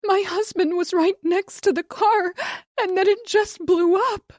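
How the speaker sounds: high, loud panicked sobbing; wife sobbing to the dispatcher. A narrator's reading. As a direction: sob it out in panic. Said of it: "she said, sobbing".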